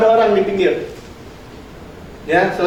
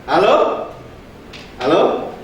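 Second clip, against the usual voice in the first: ordinary talk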